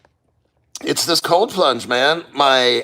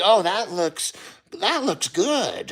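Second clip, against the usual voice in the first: nice warm person; 4 semitones higher, 5 dB quieter, slower than usual. Retold or imitated. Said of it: "everybody who's nice and warm is like".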